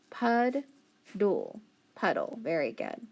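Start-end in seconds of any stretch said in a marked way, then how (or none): 0.1-1.6 "pud-dul" said as two separate beats; syllables split. Shown as a demonstration. Teacher splitting the word into syllables for the class. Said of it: "So I have".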